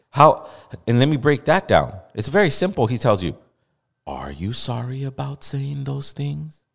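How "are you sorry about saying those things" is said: slow, soft coddling interviewer; mock softball question. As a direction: lob it gently, softly drawn out. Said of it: "That was such an underhand question".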